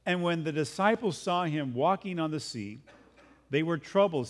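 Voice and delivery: ordinary delivery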